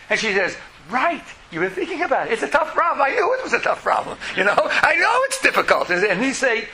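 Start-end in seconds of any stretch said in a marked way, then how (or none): 0.9-5.9 relieved agreement. Pretend. Relieved person feels understood.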